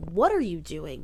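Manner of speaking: ordinary delivery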